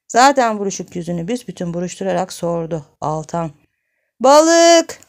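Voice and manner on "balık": loud, high single-word shout. Acted. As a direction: yell it for a deaf listener. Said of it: "diye bağırdı". (ağır işitene bağırarak)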